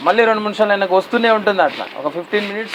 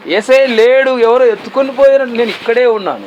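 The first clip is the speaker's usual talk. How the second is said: higher, louder urgent questioning; God questioning; 7 semitones higher, 3 dB louder than usual. Acted. God urging the weeping woman to see him, high and loud. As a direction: press her like God who is right there. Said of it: "లోపల చూస్తున్నాడు అనమాట ఆయన".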